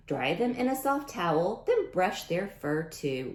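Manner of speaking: lively storybook read-aloud voice; reading to children. Acted. Teacher reading a rhyming book to kids, storybook voice. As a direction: read it out to the class with bounce.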